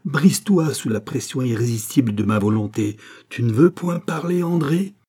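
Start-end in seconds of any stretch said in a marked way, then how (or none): 3.3-5.0 menacing demand. Acted. Gilbert presses the countess.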